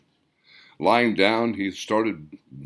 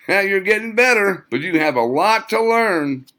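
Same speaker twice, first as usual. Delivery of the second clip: Caleb's voice; 10 semitones higher, 5 dB louder than usual. Acted. Caleb's remembered teasing words.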